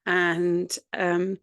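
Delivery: ordinary delivery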